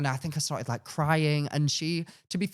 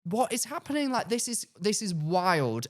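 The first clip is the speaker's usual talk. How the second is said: stunned disbelief; 7 semitones higher than usual. Retold or imitated. Retold disbelief.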